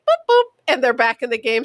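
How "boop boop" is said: higher playful boop boop; boop noises. Retold or imitated. Aunt voicing chest markers resetting, playful high boops. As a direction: make the beep of a reset button. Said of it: "they would just, like".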